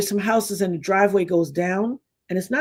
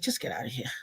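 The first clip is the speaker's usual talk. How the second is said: hushed, tired mother brushing her off; dismissive whisper; 6 semitones lower, 14 dB quieter than usual. Retold or imitated. Mother dismisses her daughter, whispered. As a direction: shoo her away under your breath.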